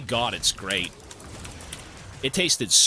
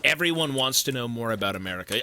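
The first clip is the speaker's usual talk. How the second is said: normal talking voice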